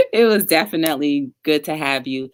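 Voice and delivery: normal talking voice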